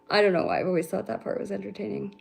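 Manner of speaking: ordinary talk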